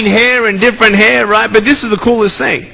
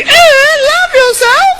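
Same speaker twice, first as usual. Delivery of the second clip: much higher, disbelieving "love yourself?"; mock skeptical squeak; slower than usual. Kids doubting the speaker, mock high skeptical squeak. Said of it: "you're probably thinking".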